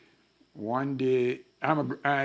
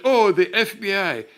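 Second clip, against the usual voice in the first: loud, higher sarcastic greeting; mocking sarcasm; 8 semitones higher, 8 dB louder than usual. Lawyer taunting the FBI men, loud mock cheer. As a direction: needle the agents with fake cheer.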